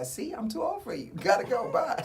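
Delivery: high teasing brush-off; mock dismissal. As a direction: play it airy and dismissive. His mock dismissal of woman.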